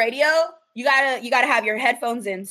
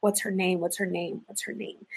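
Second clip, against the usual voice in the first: softer and lower, fading to a whisper; muttering to oneself; 4 semitones lower, 10 dB quieter than usual. Real, not acted. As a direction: search your memory under your breath. Searching memory, talking to herself.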